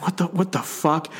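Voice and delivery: dazed half-voiced shock; stunned disbelief. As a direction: gasp it out in disbelief. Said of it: "He's like".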